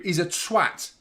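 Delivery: usual speaking voice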